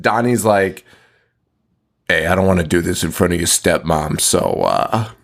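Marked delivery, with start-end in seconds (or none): 2.1-5.1 lightly voiced low, casual cop; mock an awkward, low-key arrest; a hint of an awkward officer, pitched down; reluctant cop. Acted cop making an awkward arrest.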